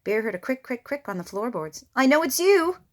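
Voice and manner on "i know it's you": higher, louder shaky bravado; nervous bravado. A narrator's reading. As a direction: pretend to be sure.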